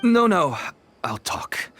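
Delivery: panicked surrender. Acted. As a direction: blurt it out scared, then cave in a whisper. Dealer panics at the threat.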